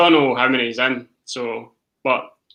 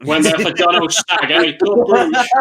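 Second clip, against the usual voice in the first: nightclub hype; 3 semitones higher, 4 dB louder than usual. Acted. Mocking nightclub club name.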